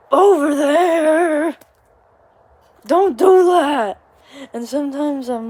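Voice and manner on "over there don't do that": mock yell. Mock yell, parent pointing.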